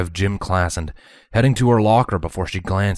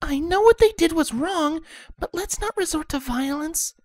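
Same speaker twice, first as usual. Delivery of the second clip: timid Fluttershy. Acted. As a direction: gently urge calm. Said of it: "Fluttershy shook her head".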